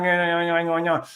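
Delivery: mock Australian twang through the nose; run-together nasal Australian yammer; nasal Australian impression. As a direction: imitate native Australians talking through their noses. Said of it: "your vocalization through your nose".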